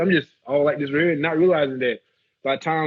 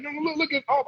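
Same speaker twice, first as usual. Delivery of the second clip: high, hurried pleading; panicked pleading; 13 semitones higher, 4 dB quieter than usual. Retold or imitated. Tourist pleads with the armed guard that his camera is off, high and panicky. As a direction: beg him to see the camera is off.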